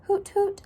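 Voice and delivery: high spoken owl hoots. A narrator's reading. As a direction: hoot it high, as the storybook owl. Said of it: "said Owl".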